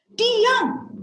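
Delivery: high, loud command to hush; stern parental shout. Acted. Parent to crying child, sharp high shout.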